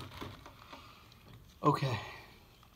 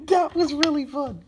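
high laugh into a squeal; real breathless giddy laughter; 12 semitones higher than usual. Real, not acted. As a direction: laugh it out, thrilled. Giddy after challenge.

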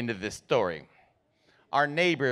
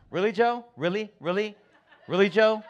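high, rising disbelieving questions; mom's exasperation; 5 semitones higher, 4 dB quieter than usual. Retold or imitated. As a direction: repeat it higher, incredulous, like a fed-up wife. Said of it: "most repeated phrase in our house".

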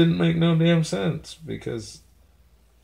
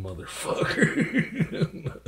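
laughing; 7 semitones higher, slower than usual. Pretend. Threat dissolving into laughter.

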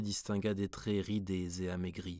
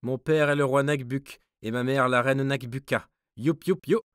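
mocking dwarf; 5 semitones higher, 9 dB louder than usual. A narrator's reading. Mocking dwarf taunts guard.

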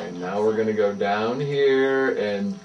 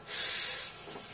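snake hiss; 12 dB quieter than usual. Pretend. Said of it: "be a snake".

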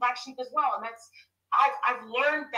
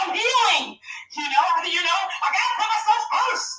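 high, loud insistence; emphatic declaration; 8 semitones higher, 3 dB louder than usual. Real, not acted. Speaker to strangers insisting loudly on her healing. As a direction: stand your ground. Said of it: "asserting herself loudly".

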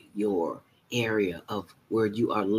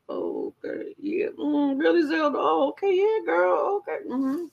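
much higher, airy voice backing away from bad breath; high mocking voice of a friend pretending to listen; mock polite friend. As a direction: humour someone while dodging their breath. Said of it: "talking to you like this".

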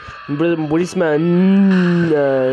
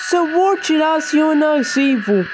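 scared giddy laughing; 12 semitones higher than usual. Real, not acted. High excited warning.

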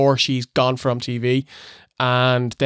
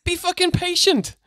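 mock exasperation. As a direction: scold an impatient crowd in a squeaky high voice. Mock scolding of impatient crowd.

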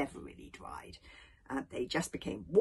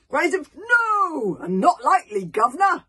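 loud rowdy crowd cries; crowd shouts; 11 semitones higher, 13 dB louder than usual. Acted. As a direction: shout it like a mob of Vikings.

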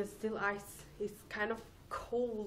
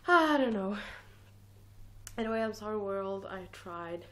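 ordinary talk, nothing marked

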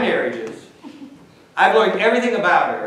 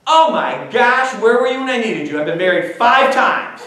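excited outburst; 5 semitones higher, 5 dB louder than usual. Retold or imitated. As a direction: gush like the woman who has been married five times. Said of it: "She goes".

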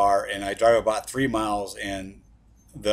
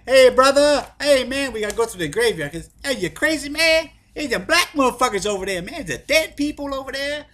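spooked friend in a much higher, strained put-on voice; Rufus; 7 dB louder than usual. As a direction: squeal it out like a friend scared of the graveyard. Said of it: "I'm gonna do the voice of, uh, Rufus".

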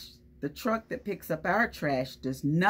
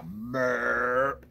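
low burp; 6 semitones lower than usual. Shown as a demonstration. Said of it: "That's the sound it makes".

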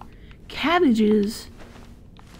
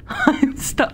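higher, louder, half-laughing; exasperated outburst; 9 semitones higher, 6 dB louder than usual. Real, not acted. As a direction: blurt out "I'm stuck" in laughing frustration. Stuck in game, laughing exasperation.

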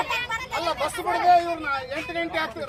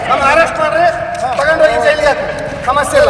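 forceful, much louder challenge; loud defiance; 14 dB louder than usual. Real, not acted. Protester daring authorities to arrest them, loud defiance. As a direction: dare them loudly to go ahead.

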